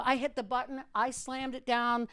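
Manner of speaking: usual speaking voice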